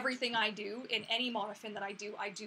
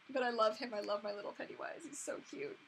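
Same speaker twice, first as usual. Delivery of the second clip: ordinary delivery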